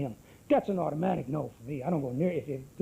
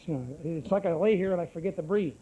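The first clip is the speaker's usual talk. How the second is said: normal talking voice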